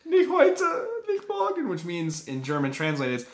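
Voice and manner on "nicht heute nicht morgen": put-on whiny pleading kid. Pleading to mom in German.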